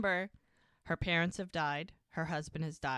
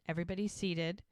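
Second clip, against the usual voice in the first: ordinary talk, nothing marked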